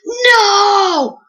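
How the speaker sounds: put-on high, loud kid's scream of protest; screaming bloody murder. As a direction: shriek it like a five-year-old losing his mind. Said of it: "screaming bloody murder".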